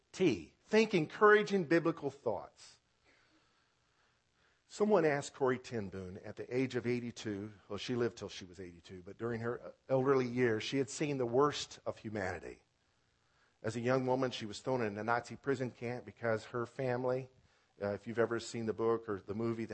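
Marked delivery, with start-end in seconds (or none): none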